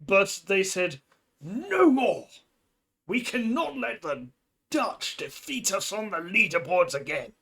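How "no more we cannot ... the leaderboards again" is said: mock outrage. Speedrunner swearing off defeat by the Dutch, mock outrage. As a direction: cry out like a melodramatic rival.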